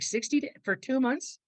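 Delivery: high, disbelieving squeak; incredulous. Retold or imitated. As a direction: balk at the number in shock. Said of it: "I'm like".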